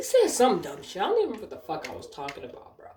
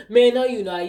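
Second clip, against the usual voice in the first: imitating a customer's loud, higher protest; loud, pitched-up contradiction, acted; mock loud disbelief; 6 semitones higher, 9 dB louder than usual. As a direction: blurt out a retold objection. Retold customer disputes her answer.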